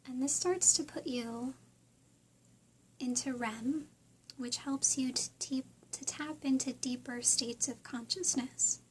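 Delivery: soft, unhurried meditation voice with long pauses; guided meditation. Real, not acted. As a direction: gently guide listeners through breathing and visualizing.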